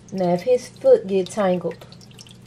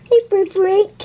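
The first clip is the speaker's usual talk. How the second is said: loud, much higher sing-song coax to a pet; high sweet calling; 12 semitones higher, 5 dB louder than usual. Real, not acted. A grandma calling her pet Brick Brick out of his shell, high and loud. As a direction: call him out like a doting grandma.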